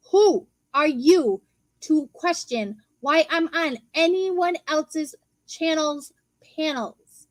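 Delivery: "Who are you" slow and hit hard, word by word; slow it right down, spacing "anyone else's channel's panels"; slow, emphatic. Retold or imitated. Retort to someone questioning their panel spot, slow and emphatic.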